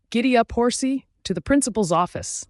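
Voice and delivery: high, loud rallying cry; playful yell. A narrator's reading. Girl riding guard's back, yelling. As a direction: call it out gleefully, starting very high. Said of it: "I yelled".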